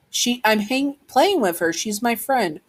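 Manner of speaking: put-on high-pitched young child answering back; lightly acted kid defending a friend; protesting child. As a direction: raise the pitch into a small, protesting voice.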